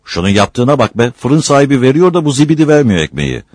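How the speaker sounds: high, loud outrage; indignant complaint. Acted. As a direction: complain hotly about an injustice. Complaining about the refused bread. (öfkeli, yüksek sesle yakınma)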